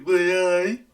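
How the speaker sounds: high, loud Geordie cry; hammed-up Geordie exclamation; mock Geordie. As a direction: call it out in exaggerated Geordie. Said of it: "Do a Geordie".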